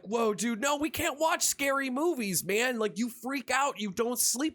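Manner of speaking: high cartoon dude voice; Mordecai. Blue jay warning his friend about scary movies, high protesting voice. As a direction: pitch it well up, urgently talking a buddy out of it. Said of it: "Mordecai's all like".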